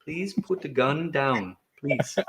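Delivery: calm, soothing voice. Retold or imitated. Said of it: "your very calming voice".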